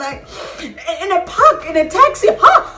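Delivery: high-pitched, rising to a loud squeal; appalled disbelief. Retold or imitated. Storyteller appalled at sex in a taxi, squealing disbelief.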